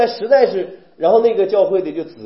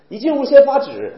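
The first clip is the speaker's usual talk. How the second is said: normal talking voice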